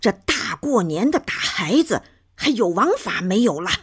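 high-pitched grandmother telling someone off; indignant scolding. A narrator's reading. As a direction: scold with outrage, pitch held up. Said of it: "奶奶一边斥责".

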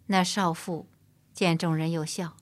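usual speaking voice

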